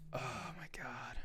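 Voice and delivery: slow, whispered groan of dread; whispered exasperation at what's coming. Real, not acted. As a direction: exhale it slowly, without voice. Dreading hours of work stress.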